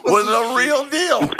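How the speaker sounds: high, laughing self-mockery. Real, not acted. Self-mocking laughing admission.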